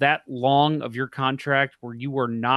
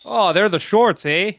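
hammed-up Canadian accent, higher and louder; mock Canadian accent; 6 semitones higher, 6 dB louder than usual. Teasing Canada about the shorts joke in a mock Canadian accent. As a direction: play a jokey Canadian with the eh. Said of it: "with that accent".

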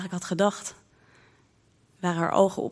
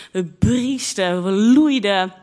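drawn-out, swelling words; slow, loud, playful bellow; hammed-up bellowing; 3 semitones higher, 7 dB louder, much slower than usual. A narrator's reading. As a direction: stretch the verbs into a loud moo. Teller acts out the silly noises.

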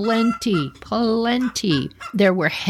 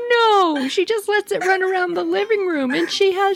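higher, embarrassed retelling of the caller; 11 semitones higher than usual. Retold or imitated. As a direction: sound a little ashamed, pitched up. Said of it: "she's so embarrassed".

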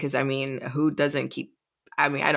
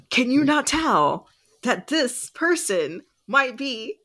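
high, drawn-out mock plea; exasperated plea; 9 semitones higher, slower than usual. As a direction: spell it out slowly, pitched high in exasperation. Acted exasperated plea to the characters.

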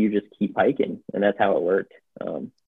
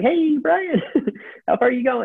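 much higher, sing-song greeting with a laugh; greeting Brian again in a much higher playful voice; chirpy call-out. Retold or imitated. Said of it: "I'd be like".

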